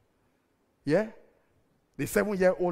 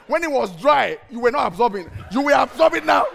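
loud, high teasing parent; mock father reply; 8 semitones higher, 11 dB louder than usual. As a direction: tease the child loudly. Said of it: "father answers child".

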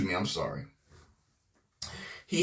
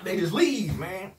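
ordinary talk

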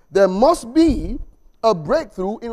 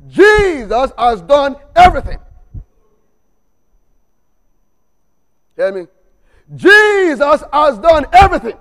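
louder, higher each repeat; building proclamation; 5 semitones higher, 8 dB louder than usual. Shown as a demonstration. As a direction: preach it harder every time. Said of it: "I'll take it again".

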